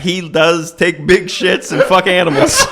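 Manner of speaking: slow, laughing through the words; can barely get it out for laughing; giggling mock quote.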